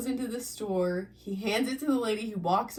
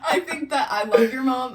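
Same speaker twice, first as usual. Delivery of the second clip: louder, laughing through it; laughing affection; 4 semitones higher, 7 dB louder than usual. Real, not acted. Friend cracking up about his mom, laughing. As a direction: say it while still laughing.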